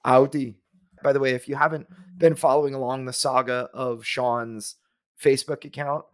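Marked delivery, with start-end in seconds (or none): 0.0-0.6 Audi said the German way, loud and falling; German-accented Audi with a falling tune. Shown as a demonstration. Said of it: "You have to say it with a German accent".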